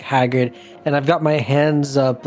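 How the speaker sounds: usual speaking voice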